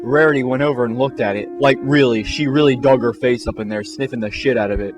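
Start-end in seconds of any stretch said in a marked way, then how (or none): none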